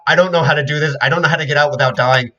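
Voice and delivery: high, loud frantic babble; panicked rambling. Acted. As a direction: beg in a panic. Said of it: "he just spoke in two times speed".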